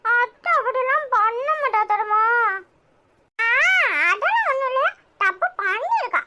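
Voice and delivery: ordinary talk, nothing marked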